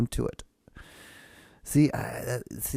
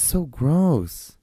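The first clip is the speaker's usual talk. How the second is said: slow, louder disgusted groan on gross; drawn-out disgust; 8 semitones higher, 7 dB louder than usual. Real, not acted. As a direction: stretch it out, truly grossed out. Disgusted by mouth-full talkers.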